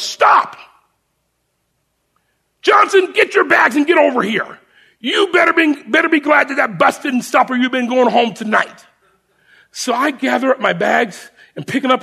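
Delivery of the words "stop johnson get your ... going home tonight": retold yell. Sergeant calling recruit back, imitated yelling. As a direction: bark him back into line. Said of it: "he yells".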